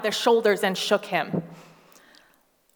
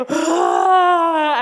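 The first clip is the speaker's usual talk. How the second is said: mock child's loud dismayed "Ah"; high, loud kid's wail; gasp of dismay; 8 semitones higher, 10 dB louder than usual. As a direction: cry out like a child whose toy broke. Child's Lego creation breaks.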